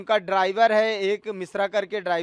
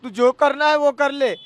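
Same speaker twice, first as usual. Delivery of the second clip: imitating an arrogant threat, rising to louder; mock defiant taunt that gets louder; retold boast; 5 semitones higher, 6 dB louder than usual. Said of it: "वो बोलता है". (घमंडी धमकी की नकल, आवाज़ ऊँची होती हुई)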